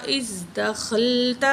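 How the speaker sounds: اذ said with a clear "iz", not "aza"; teacher models the correct reading; correct pronunciation. Shown as a demonstration. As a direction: say اذ as "iz", then دخلت. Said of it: "اذ، ازا نہیں ہے".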